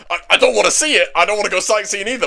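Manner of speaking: stammered, over-eager mock denial; hasty denial. Demon Lord denies wanting the festival, hastily.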